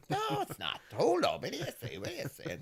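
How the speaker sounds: high incredulous mock dad. Dad refusing mum's girl prediction, mock incredulous. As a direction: play dad's disbelief, hammed-up. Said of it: "And he was like".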